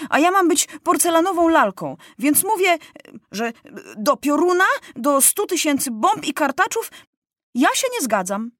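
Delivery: fed-up, high-pitched protest; indignant boy king. A narrator's reading. Young king rebels against his ministers.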